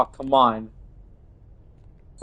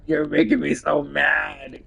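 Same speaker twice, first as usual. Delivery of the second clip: usual speaking voice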